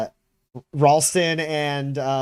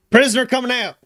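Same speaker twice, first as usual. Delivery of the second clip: higher, louder guard call announcing a prisoner; 10 semitones higher, 5 dB louder, faster than usual. Acted. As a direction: bark it out to the other guards. GM voices guard calling out.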